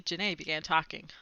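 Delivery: ordinary delivery, nothing marked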